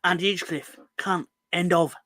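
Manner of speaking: put-on a light London football-fan accent, blunt; London fan accent, delivered as a blunt verdict; imitating a Millwall fan's accent. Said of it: "So I could do his accent".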